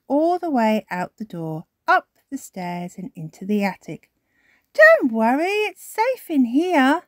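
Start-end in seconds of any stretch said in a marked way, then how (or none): 4.7-7.0 put-on high child voice, soothing; calm your brother down; reassuring Peppa. A narrator's reading. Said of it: "says Peppa".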